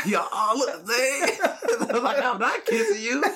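high, slow, giggling husband voice; laughing mock husband. Husband acted out to co-host, squealed with laughter.

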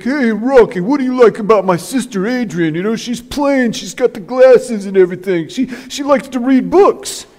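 hammed-up Philly tough-guy accent; Paulie impression. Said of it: "I should stop my accents".

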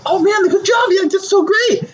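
high-pitched, over-the-top cheering; exaggerated high squeal of approval; gushing mock congratulations. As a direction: shout it like a thrilled buddy, hammed up. Acted friend's congratulation, much higher.

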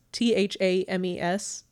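ordinary delivery

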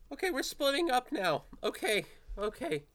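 high-pitched frazzled character voice; tearful, frantic. Acted. Philip rattles out a plan while wiping away tears, high and frantic. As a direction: pitch it up, frantic after crying. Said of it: "still wiping away tears frantically".

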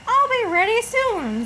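high, eerie sing-song call; high spooky call. Pretend. Calling out to ghosts again.

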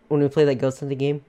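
ordinary talk

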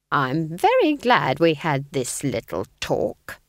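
slow, menacing hiss. A narrator's reading. Nanny hisses a false pleasantry at Granny. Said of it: "hissed Nanny Ogg".

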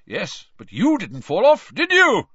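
loud, high, hearty bellow; genial roar. A narrator's reading. Proud father slaps his son's back, genial roar. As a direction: roar it jovially, leaping up on the question. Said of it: "roared Amos genially".

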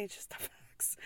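whispered through a laugh. Real, not acted. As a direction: breathe it out with a giggle.

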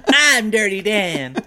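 high, loud, slow, laughing cartoon voice; Dirty Dan voice. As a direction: ham it up like a grimy cartoon guy. Jokingly claiming the Dirty Dan role.

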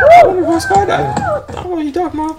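defending himself in a squeaky whine to his friend; high whiny protest. Real, not acted. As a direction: drag it out in a high, put-upon whine. Repeats the defence, mock protest.

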